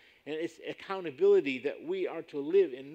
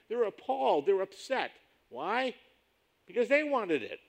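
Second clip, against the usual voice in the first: higher and louder, acting out the disciples' outrage; a retold flare of anger, pitched up; mock indignation; 8 semitones higher, 6 dB louder than usual. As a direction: preach their indignation with heat.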